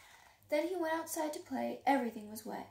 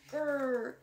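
playful growl; 5 dB louder than usual. A narrator's reading. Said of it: "got inside his cave and growled".

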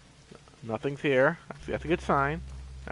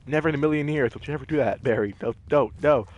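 usual speaking voice

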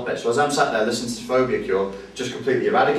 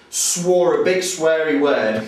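ordinary delivery

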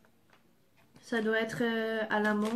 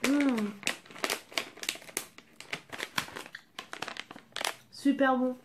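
munching crunchy snack; talking while chewing. Real, not acted. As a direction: mouth partly full. Seen 2: Lips closed, chewing corn snack while talking after eating pieces.

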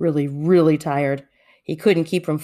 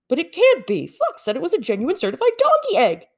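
disbelieving; 11 semitones higher than usual. A narrator's reading. Bear protests in disbelief.

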